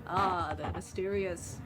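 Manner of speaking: slow, hushed documentary voice; nature documentary narrator. Pretend. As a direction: narrate like a wildlife film. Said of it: "voicing this like a nature documentary".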